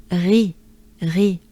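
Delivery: ri with a throaty French R, lips in a wide smile. Shown as a demonstration. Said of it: "it follows the French R".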